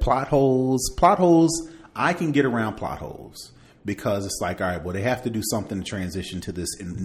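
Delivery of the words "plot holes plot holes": louder, higher each time; emphatic repeat. Real, not acted. Podcaster venting about plot holes, louder each time. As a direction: stress the complaint, second time bigger.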